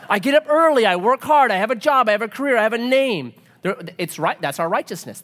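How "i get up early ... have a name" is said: high, loud list of brags; self-righteous boasting. Acted. Preacher voicing someone proud of job and name, boastful. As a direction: reel off your merits proudly, higher and louder.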